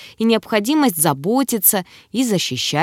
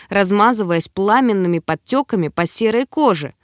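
ordinary delivery, nothing marked

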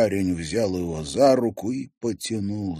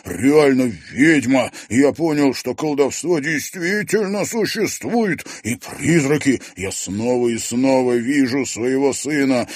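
higher, louder frantic raving; agitated; 6 semitones higher, 8 dB louder than usual. Acted. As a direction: rave like a man possessed. Journalist raves about witchcraft.

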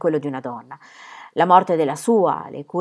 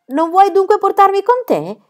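much higher, louder, about to cry; child's cry; 14 semitones higher, 7 dB louder than usual. Acted. Little Pierre begs his father to take him, whining cry. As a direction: wail like a child left behind. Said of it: "gridò il piccolo".

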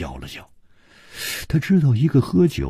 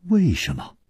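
high-pitched startled question, falling away; astonished; 10 semitones higher than usual. A narrator's reading. Chu asking why she must kill, astonished. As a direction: ask it in mild shock. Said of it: "楚留香更吃惊了".

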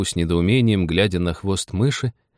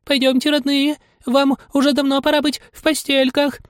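very high, quavering bird voice; trembling canary. A narrator's reading. Canary calling her children to bed, trembling. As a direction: quaver anxiously, pitched way up. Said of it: "канарейка дрожащим голосом".

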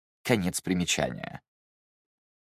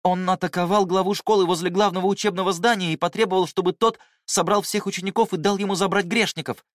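put-on high, worried young disciple; anxious young man; 10 semitones higher, 3 dB louder than usual. A narrator's reading. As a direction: voice him alarmed and tense. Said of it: "встревоженно произнёс Гуюн".

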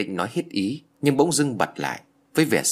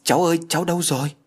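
high, quick anxious call; worried, hurried search cry; panicked calling; 8 semitones higher, 3 dB louder, faster than usual. A narrator's reading. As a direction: call out for a missing child in alarm. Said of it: "Anh hốt hoảng".

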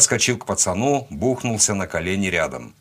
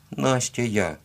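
put-on higher little girl, a touch tearful; mild sob; 4 semitones higher, slower than usual. A narrator's reading. Crying girl telling her rescuer her name, mildly sobbing. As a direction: answer through a light sniffle. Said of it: "всхлипнула она".

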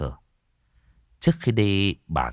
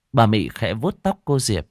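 ordinary delivery, nothing marked

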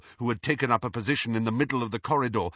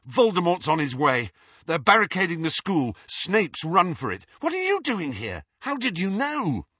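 louder, hurried urgent report; 6 semitones higher, 3 dB louder than usual. Acted. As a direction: rush the news out.

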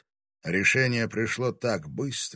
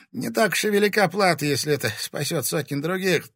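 high, louder, easing at the end; strained resolve; 8 semitones higher, 5 dB louder, faster than usual. A narrator's reading. Timofey giving his life for others, strained resolve. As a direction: push it out, then let it subside. Тимофей готов умереть ради других.

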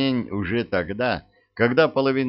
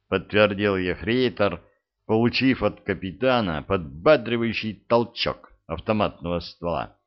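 ordinary delivery, nothing marked

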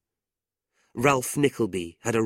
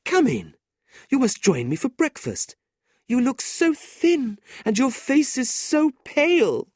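fussing landlady; 5 dB louder than usual. A narrator's reading. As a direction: fuss over him like a kindly aunt. La Creevy welcomes Nicholas.

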